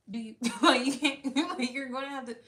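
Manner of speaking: loud, laughing through the words. Real, not acted. Lecturing viewers about owning a dog, real laughter breaking in. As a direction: crack up while making the point.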